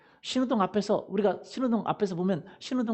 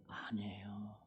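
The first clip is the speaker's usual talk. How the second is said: whisper; 13 semitones lower, 17 dB quieter, much slower than usual. Acted. Secret told to congregation.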